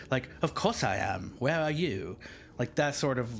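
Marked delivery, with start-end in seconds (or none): 0.4-2.2 put-on haughty British accent. Like royalty speaking to a servant, haughty British accent. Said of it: "very haughty tone, like, with an attitude".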